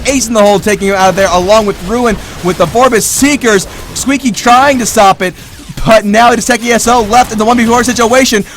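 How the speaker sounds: hyped shoutcaster in a team fight; excited play-by-play. Real, not acted. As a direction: urge them on and call every hit. Live esports play-by-play.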